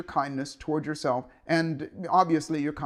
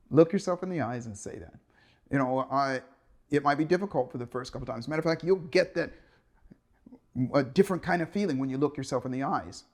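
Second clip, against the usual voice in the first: ordinary delivery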